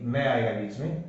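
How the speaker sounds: ordinary delivery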